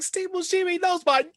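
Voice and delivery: high, giddy re-enacted thrill; starstruck. Actor recognized by the star, starstruck. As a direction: squeal it like an overjoyed fan.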